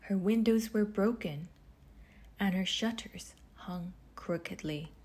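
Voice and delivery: ordinary delivery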